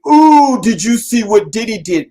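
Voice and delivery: mock gossip. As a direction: play a thrilled busybody spreading news. Mocking gossipers' excited chatter.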